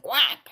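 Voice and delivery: high, loud quack; duck quack. A narrator's reading. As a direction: imitate a duck with one quick quack. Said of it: "said the duck".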